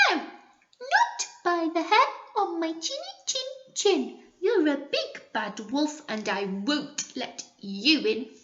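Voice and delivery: high little pig, refusing. Acted. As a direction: squeak the refusal like a small pig. Pig answers the wolf.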